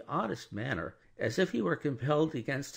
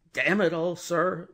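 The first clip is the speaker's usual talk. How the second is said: louder, higher exasperated oath; exasperated outburst; 8 semitones higher, 7 dB louder than usual. Acted. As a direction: blurt it out, losing patience. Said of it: "staring at him".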